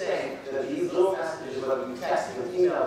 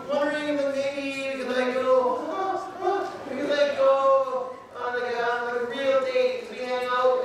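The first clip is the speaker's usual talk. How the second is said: tearful asking; 7 semitones higher than usual. Retold or imitated. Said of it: "she just starts to cry".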